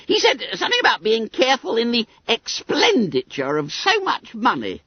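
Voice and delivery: high, squeaky schoolboy voice; self-satisfied Billy Bunter. A narrator's reading. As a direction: pipe it up high, full of importance.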